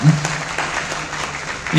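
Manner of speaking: usual speaking voice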